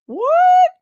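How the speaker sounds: high, loud shocked yelp; startled what. Real, not acted. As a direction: jolt up, caught off guard. Startled outburst at chat.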